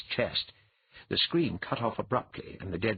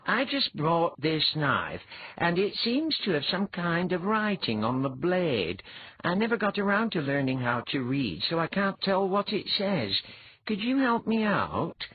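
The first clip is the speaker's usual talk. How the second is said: put-on polite young petitioner; 10 semitones higher, 3 dB louder than usual. A narrator's reading. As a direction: politely ask for help, pitched well up. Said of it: "Eliar said politely".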